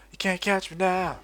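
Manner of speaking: playful gamer taunt; sing-song taunt. Real, not acted. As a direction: tease the chasers slowly. Taunting enemies while escaping.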